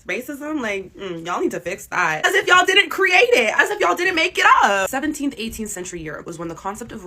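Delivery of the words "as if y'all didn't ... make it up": loud, slow, higher angry retort; exasperated outburst. Real, not acted. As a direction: throw the accusation right back, loud and slow. Ranting at European hypocrisy.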